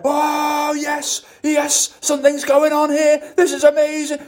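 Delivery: high, thrilled voice; excited rush. Retold or imitated. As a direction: gush like it's the best thing ever. Acting the motivation rush.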